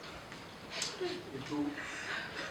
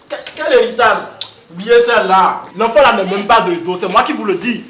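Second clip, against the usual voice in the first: loud scoffing; 9 dB louder than usual. Acted. Brother dismisses the mistress's claim.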